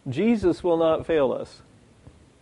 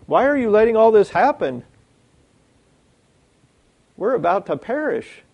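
higher-pitched, acted alarm; mock-panicked complaint; panicked disciples; 6 semitones higher, 4 dB louder than usual. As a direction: plead as if losing it. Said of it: "These guys have lost it".

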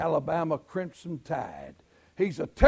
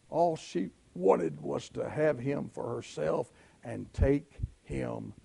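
usual speaking voice

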